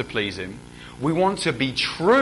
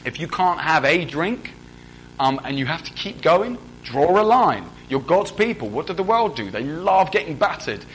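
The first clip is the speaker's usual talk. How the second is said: ordinary delivery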